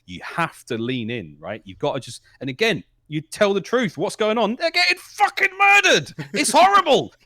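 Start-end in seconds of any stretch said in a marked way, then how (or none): 2.4-7.1 much higher and louder, slow; heated high rant. Real, not acted. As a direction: build to an outraged peak. Ranting about team getting murdered.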